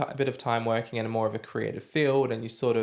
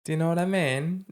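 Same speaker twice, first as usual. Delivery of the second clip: mock English accent; 6 semitones higher than usual. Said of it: "adopting an English accent".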